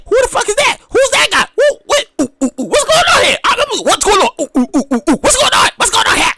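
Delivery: high, baffled "who is that?"; wide-eyed bewildered fan; "ooh, what's going on" in mock alarm; confused shock. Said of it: "I gotta be like".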